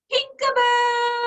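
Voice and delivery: hammed-up little brother yelling Pinkaboo; little brother's yell. A narrator's reading. Peter yells at story end.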